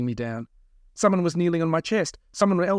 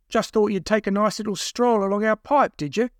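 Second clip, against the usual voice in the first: higher-pitched sarcastic needling; lightly taunting, pitched up; mocking kidnapper; 7 semitones higher, 4 dB louder than usual. A narrator's reading. Second kidnapper to captive about his walk, mocking.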